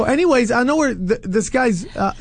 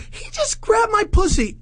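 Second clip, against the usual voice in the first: very high mock-female voice; high-pitched imitation of an indignant woman; outraged woman imitation; 13 semitones higher than usual. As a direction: repeat the complaint in a squealing high voice. Woman's accusation repeated in her voice.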